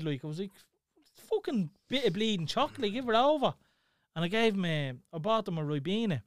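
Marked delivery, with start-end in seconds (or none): 1.3-3.5 higher-pitched retold telling-off; mock-snap at a fussing child; exasperated scolding. Parent telling crying Tyler off over chocolate, exasperated.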